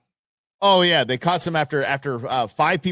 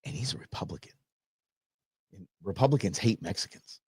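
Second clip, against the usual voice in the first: whispered gossip; 8 semitones lower, 8 dB quieter than usual. Retold or imitated. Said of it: "She was".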